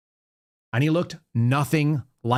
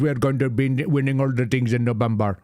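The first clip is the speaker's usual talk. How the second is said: mock campaign-speech voice imitating the guy; put-on candidate voice, ridiculing his promises; mocking a politician. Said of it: "the guy that talks like this".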